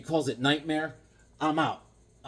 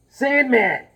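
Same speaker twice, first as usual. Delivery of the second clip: dramatic announcement; 10 semitones higher, 9 dB louder than usual. Pretend. Wearing mask, announces Sandman.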